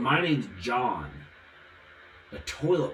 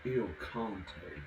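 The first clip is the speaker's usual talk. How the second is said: quieter, lower murmured Il Conte; low mock voice; 4 semitones lower, 8 dB quieter than usual. Showing the voice that talks like that, low mock murmur. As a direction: drop low into a sly voice. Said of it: "Somebody talks like that".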